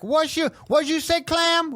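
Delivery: high, put-on confrontational voice; Bahad Bahabi. Imitating her confronting a clam underwater, mock-indignant. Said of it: "She'd be like".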